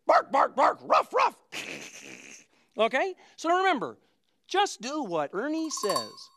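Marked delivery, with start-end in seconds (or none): 0.1-1.4 high playful pretend barks; dog barking. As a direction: yap like a puppy. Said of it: "play Ernie Says About Animals".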